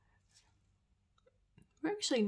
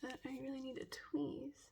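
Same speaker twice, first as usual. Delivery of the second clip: much softer trailing whisper; 4 semitones higher, 13 dB quieter than usual. Real, not acted. Inspecting her brows, whisper. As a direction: say it to yourself.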